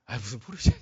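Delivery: a low, offhand scoff at 포르쉐냐; muttered inner scoff. Retold or imitated. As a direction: toss off 포르쉐냐 under the breath. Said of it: "속으로 들죠". (속으로 비웃듯 가볍게)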